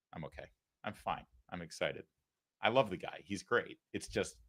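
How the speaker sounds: quietly convince yourself you're okay; shaken, talking to himself. Acted. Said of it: "Little shaken up".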